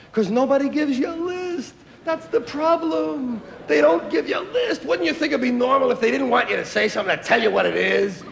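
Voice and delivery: high-pitched comic griping; high, aggrieved whine, played for laughs; exasperated complaint. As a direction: pitch it way up in mock outrage. Mock complaint about having no list.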